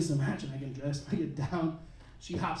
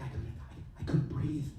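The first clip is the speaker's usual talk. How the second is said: weak whispered stammer; breathless whisper; 6 semitones lower, 10 dB quieter than usual. Retold or imitated. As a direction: barely get it out, winded. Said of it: "It's like".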